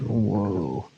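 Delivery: usual speaking voice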